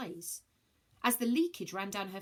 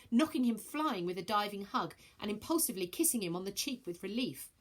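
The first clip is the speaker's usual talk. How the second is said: plain reading voice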